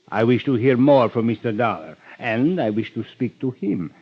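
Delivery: normal talking voice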